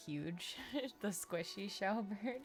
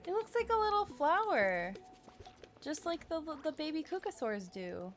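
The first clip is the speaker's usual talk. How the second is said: very high, loud gushing over cuteness; cooing; 7 semitones higher, 5 dB louder than usual. Real, not acted. Streamer admiring a little flower-like creature, high cooing. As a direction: gush in a high coo.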